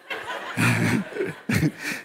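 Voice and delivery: quick airy laugh at his own joke; breathy laugh. Real, not acted. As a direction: let a breathless laugh slip out. Crowd joke about being hungry.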